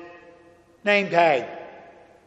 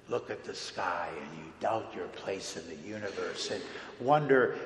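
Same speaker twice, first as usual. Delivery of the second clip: much softer, lower, intimate reassurance; tender, hushed Jesus; 6 semitones lower, 6 dB quieter than usual. Acted. Jesus gently comforting Peter, soft and hushed. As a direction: whisper comfort to a frightened friend.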